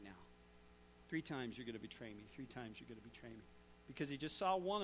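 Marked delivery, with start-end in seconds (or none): 1.1-2.2 low, soft voice of a remembered warning; Jesus' words come back to him softly, low; echoing memory. Retold or imitated. Preacher voices Jesus' words echoing in Peter's mind, low and soft. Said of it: "Jesus' words echoing in Peter's mind". 2.3-3.4 much softer, faster, low repeat like a distant echo; fading echo. Retold or imitated. Preacher repeats Jesus' words fading in Peter's mind, faster and fainter. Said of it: "Jesus' words echoing in Peter's mind".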